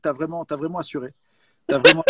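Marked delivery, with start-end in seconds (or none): 1.7-2.1 laughing. Real, not acted. Laughing while praising.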